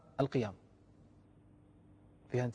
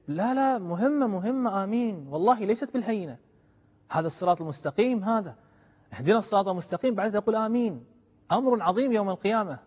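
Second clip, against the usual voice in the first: louder, higher urging from the pulpit; fervent preaching; 6 semitones higher, 6 dB louder than usual. Acted. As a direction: press the point home to the listeners. Preacher insisting, raised voice.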